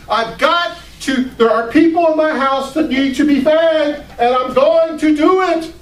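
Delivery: high, loud, frantic busy hostess; frantic Martha. Retold or imitated. Sister frets to guests, high and frantic. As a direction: voice a stressed sister rushing to feed everyone.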